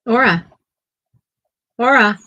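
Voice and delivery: loud, high call to the dog. Real, not acted. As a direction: call her name across the room. Calling the dog by name.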